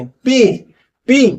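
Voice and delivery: "पी" with the lips popping open; teacher pops "पी" again; P sound demo. Shown as a demonstration. Teacher repeating the P sound for students. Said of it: "lips खोलने पड़ेंगे".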